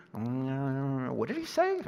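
slow, drawn-out pondering with mumbles; munch slowly through the question; chewing it over. Acted. Listener chewing on the words of Jesus, slowly pondering. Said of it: "It means to chew".

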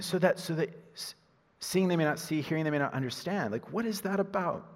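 usual speaking voice

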